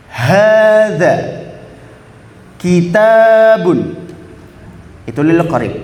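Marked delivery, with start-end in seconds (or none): 0.1-4.0 drawn-out, deliberate Arabic demo; slow model phrase. Shown as a demonstration. Teacher modelling for students, slow and clear. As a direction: stretch each word for the class to copy. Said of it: "Bisa diulangi bareng-bareng".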